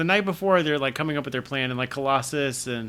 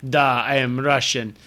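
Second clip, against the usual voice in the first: slow mock Russian accent; 3 dB louder, slower than usual. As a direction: say it with a classic cartoon Russian accent. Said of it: "classically they're like".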